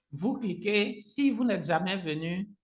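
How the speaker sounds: ordinary talk, nothing marked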